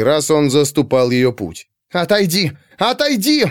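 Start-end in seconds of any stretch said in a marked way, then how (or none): 1.9-2.5 higher-pitched order to move; first, firmer demand to step aside; insistent command. A narrator's reading. Pandora to the servant blocking her way, insistent command. 2.8-3.5 very high, desperate order; frantic command. A narrator's reading. Pandora blocked by the servant, frantic high command.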